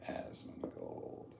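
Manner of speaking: breathy hushed whisper of the name. Acted. Streamer whispering the name back to chat. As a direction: whisper it like a secret.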